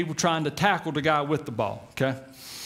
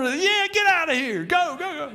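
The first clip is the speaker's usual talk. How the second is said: dismissive Pharaoh; 12 semitones higher than usual. Retold or imitated. Preacher voicing Pharaoh telling the Israelites to leave, high impatient shooing. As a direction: wave them off in a high mock Pharaoh voice.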